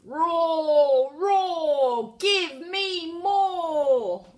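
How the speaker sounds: high, loud, slow roaring dragon; loud dragon demand. A narrator's reading. Hungry dragon demands more.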